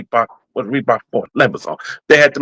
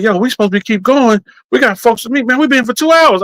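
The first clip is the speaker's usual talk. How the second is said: raised, urgent buddy voice; quoting a friend; 11 semitones higher than usual. Retold or imitated. Friend telling him they have to leave. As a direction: hurry him along, pitched up high. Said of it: "one dude, dude said".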